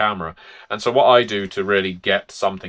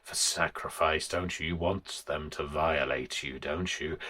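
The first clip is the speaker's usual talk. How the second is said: softer, sly pantomime villain voice; pantomime abuser shadow; 3 semitones lower, 11 dB quieter than usual. Shown as a demonstration. Game master voicing the abusive shadow to a player, hushed pantomime villain. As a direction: purr it like a hammy tormentor. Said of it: "be all speaking like this and rather pantomimey".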